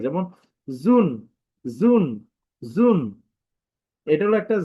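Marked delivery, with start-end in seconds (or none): 0.7-3.0 জুন said loudly with unrounded lips. Shown as a demonstration. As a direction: demonstrate the faulty জুন, lips left slack on the u. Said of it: "ঠোঁট না গোল করে উকারের মতো উচ্চারণ করে". (ঠোঁট গোল না করে জুন)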